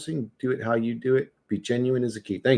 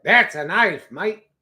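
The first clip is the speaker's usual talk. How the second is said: put-on punchier, higher Australian accent; mock Australian; 4 semitones higher, 4 dB louder, much slower than usual. Streamer quoting the man pulling out his blade, mock Australian. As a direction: put on a boastful Aussie voice. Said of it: "pulls out a fucking ninja sword".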